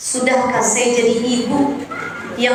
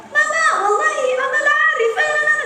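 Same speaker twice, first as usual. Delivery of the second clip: put-on high, far child voice chattering in Arabic; 13 semitones higher than usual.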